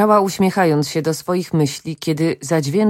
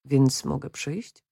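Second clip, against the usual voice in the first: cicha, powolna, pokorna prośba; pokornie; 3 semitones lower, 7 dB quieter, slower than usual. A narrator's reading. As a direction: zapytaj potulnie, ściszonym głosem. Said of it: "zapytał pokornie". (pokorne, nieśmiałe pytanie z wahaniem)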